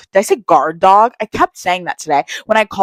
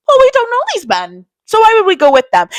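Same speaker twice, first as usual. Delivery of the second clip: much higher, singsong cautious friend; mock high warning; 4 dB louder than usual. To friends who met some guys, high mock caution. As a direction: pitch it way up, a wary mom warning. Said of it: "I'm like".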